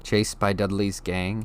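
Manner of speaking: plain reading voice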